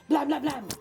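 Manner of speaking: plain reading voice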